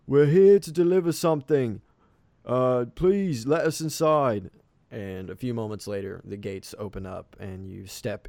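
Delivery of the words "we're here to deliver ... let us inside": raised, high voice carrying up; calling out. A narrator's reading. Captain asking the villager to open up, calling out. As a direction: shout the request up to the wall. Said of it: "And the captain calls out".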